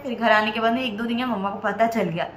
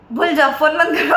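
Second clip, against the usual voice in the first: laughing dismissal; 3 semitones higher, 4 dB louder than usual. Retold or imitated. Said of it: "ऐसे बोले".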